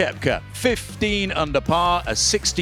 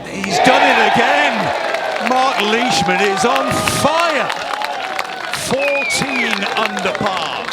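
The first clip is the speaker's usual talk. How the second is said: higher, louder celebratory call; excited call; 8 semitones higher, 5 dB louder than usual. Real, not acted. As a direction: shout the birdie out. Birdie made, excited call.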